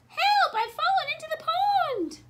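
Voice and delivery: high-pitched, loud storybook cry; calling for help. A narrator's reading. Hidden Wilbur tricks the searchers, high mock-distressed shout. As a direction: shout for rescue in a put-on high voice. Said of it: "called the voice".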